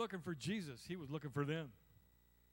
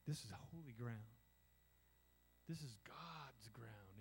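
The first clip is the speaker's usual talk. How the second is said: slow, soft reverent murmur; hushed awe; 6 semitones lower, 8 dB quieter than usual. Retold or imitated. Worshipper sensing God's presence, hushed awe. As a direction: breathe it out in awe, slowing down. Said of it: "You go".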